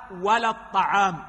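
ordinary talk, nothing marked

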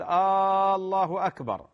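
آه، الله أكبر with a long added questioning Aa before الله, drawn out; chanted آه، الله أكبر turned into a question by the long Aa; wrong questioning Allahu akbar. Shown as a demonstration. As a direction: stretch آه into a questioning Aa then الله أكبر, demonstrating the error. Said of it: "هذا يكون الأذان به باطل".

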